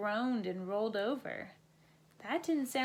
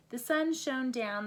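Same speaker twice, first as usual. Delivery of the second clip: usual speaking voice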